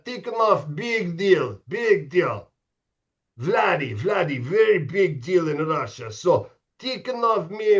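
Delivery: imitating a Russian coach with a hammed-up Russian accent. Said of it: "That was my Russian accent pretty good?".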